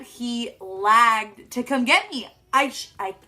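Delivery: usual speaking voice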